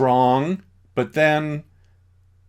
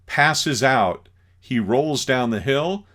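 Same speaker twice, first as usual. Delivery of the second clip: usual speaking voice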